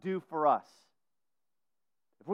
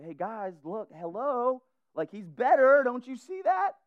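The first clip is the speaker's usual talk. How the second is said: rising higher and louder, almost begging; mock pleading; 7 semitones higher, 5 dB louder than usual. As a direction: plead with them to just look. Mock showing Jesus to crowd.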